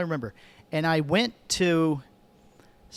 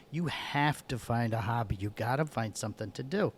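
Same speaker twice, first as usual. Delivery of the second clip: lower, softer, weary-sounding wife; firm insistence; 4 semitones lower, 6 dB quieter than usual. Retold or imitated. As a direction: quietly lay down the law, imitating a wife. Wife's retold firm advice.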